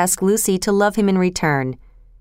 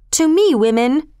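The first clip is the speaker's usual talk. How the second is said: higher-pitched, measured rallying call; crying out; 6 semitones higher, 3 dB louder than usual. A narrator's reading. Wife summons the women into battle, lightly raised rallying cry. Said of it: "cried his wife".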